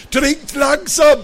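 high, loud, drawn-out call. A narrator's reading. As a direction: call slowly across from the sofa. Papa calls out from the sofa.

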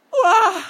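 high, loud yelp of fright; sudden horrified cry; startled scream. A narrator's reading. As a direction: cry out as if seeing the dead wake. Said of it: "Bei Harolds Schrei".

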